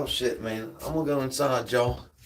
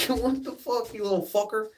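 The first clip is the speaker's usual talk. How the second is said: high-pitched mock scold; playfully cuss out a yappy little dog; 13 semitones higher than usual. Telling viewers how he yelled at the dog, high mock scold.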